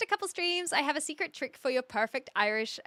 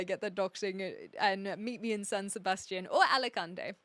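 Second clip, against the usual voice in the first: normal talking voice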